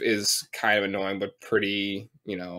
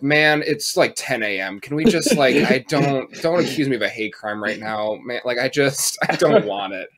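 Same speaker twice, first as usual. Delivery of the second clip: louder, exasperated plea; a louder mock-exasperated self; exasperated pleading; 4 semitones higher, 7 dB louder than usual. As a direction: beg him to drop it, exasperated. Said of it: "And I'm like".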